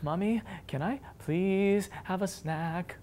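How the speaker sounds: strained, careful mock kid voice; squeaked-out child request. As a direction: imitate a child struggling to stay calm, squeezing it out slowly. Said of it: "they finally squeak out".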